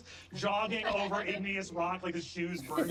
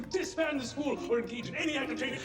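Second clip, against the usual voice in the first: high, proclaiming announcer voice; Arthur Eggford's official announcement; 6 semitones higher than usual. Acted. Headmaster announces that compelled orders are void, high official voice. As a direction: proclaim the rule like a formal public notice. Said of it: "And then you hear".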